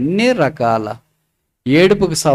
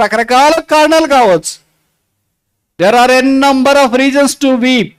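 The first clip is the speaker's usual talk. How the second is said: high, mounting sermon voice; full-force preaching; 9 semitones higher, 4 dB louder than usual. Real, not acted. Preacher tells of countless reasons to weep, high and forceful. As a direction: build the list to a high, insistent peak.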